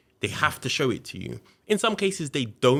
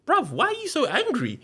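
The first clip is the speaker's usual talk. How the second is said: very high-pitched, exasperated question; retold incredulous challenge. Suspect asks the angry detective, retold incredulous challenge. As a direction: act out baffled protest, pitch jumping high.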